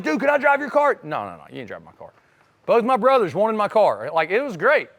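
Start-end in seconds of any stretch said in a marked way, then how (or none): 0.0-1.0 higher, eager asking voice of a buddy; a friend begging to drive the Nova, higher and eager. Retold or imitated. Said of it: "People like". 1.0-2.1 much softer, fast mumbled brush-off; the car owner refusing friends, fast and soft; quick refusal. Retold or imitated. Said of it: "People like".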